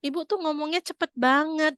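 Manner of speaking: exasperated confusion, stretched out; baffled husband. Acted. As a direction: play a husband who can't follow at all. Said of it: "Sampai suaminya bilang gini".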